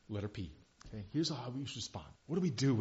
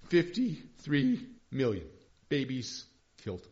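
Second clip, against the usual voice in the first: ordinary talk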